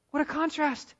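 lifted high in amazement. Shown as a demonstration. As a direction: raise it up in wonder.

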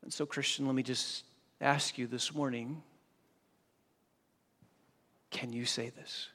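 a hushed personal question to the congregation; quiet appeal. Acted. Pastor's intimate appeal to listeners.

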